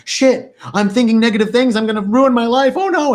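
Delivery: high, fast mock panic; hammed-up anxious self-talk; panicked inner voice. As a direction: act out a spiralling worried mind. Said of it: "but then we get into like".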